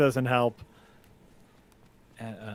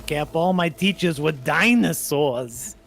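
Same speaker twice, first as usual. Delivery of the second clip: hammy New York stand-up, drawing out the punchline; bad comedian impression. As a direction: imitate an old comic telling a bad joke. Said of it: "what's his jokes now?".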